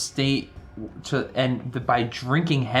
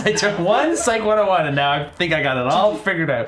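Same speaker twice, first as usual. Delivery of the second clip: higher, louder self-mocking brag; mock boast; 7 semitones higher, 5 dB louder than usual. Real, not acted. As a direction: brag about it ironically. Mock boasting about psych class.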